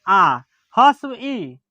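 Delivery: आ and ई drawn out slowly; slow vowel demo. Shown as a demonstration. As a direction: say आ and ई slowly and clearly. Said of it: "कम समय लगेगा तो ह्रस्व ई". (धीरे-धीरे स्वर बोलना)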